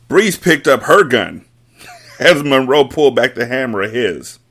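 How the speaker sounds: ordinary delivery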